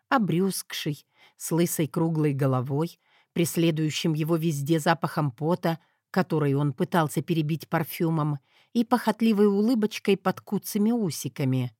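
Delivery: ordinary delivery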